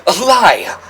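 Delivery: indignant shout. Acted. Character denies.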